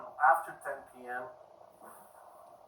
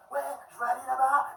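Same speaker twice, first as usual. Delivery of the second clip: high, loud French grumbling; irritated French lady; 10 semitones higher, 5 dB louder than usual. Retold or imitated. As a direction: imitate a ticket clerk going off in French. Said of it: "the other guy started to yell".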